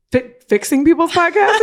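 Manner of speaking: mock disbelief at the idea; incredulous. Herself doubting the client's business idea, incredulous. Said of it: "And I was like".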